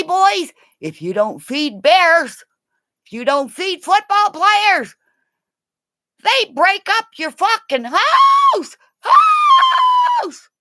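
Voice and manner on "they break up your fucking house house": shout. Real, not acted. Shouted punchline.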